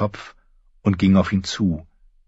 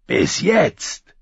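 Hound's croak; 9 semitones higher than usual. A narrator's reading. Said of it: "in seinem tiefen Krächzton".

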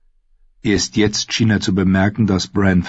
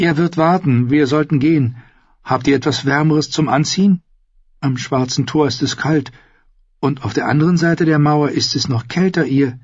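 higher-pitched earnest character voice; hapless Sam explaining; 8 semitones higher than usual. Acted.

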